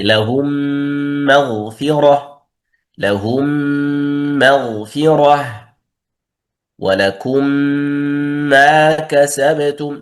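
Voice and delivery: slow recitation with stretched nasal ghunna on مغفرة; recited examples. Shown as a demonstration. Teacher recites examples to the class, slowly. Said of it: "مشدد".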